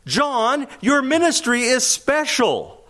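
high, slow, coaxing flattery; butter John up, leaning on "you"; flattering disciples. Acted. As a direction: disciples pleading with John about his standing. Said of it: "In other words".

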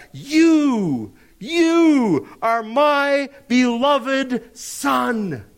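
high, very drawn-out divine declaration; ringing pulpit voice of God; God the Father proclaiming. Acted. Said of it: "the Father speaks".

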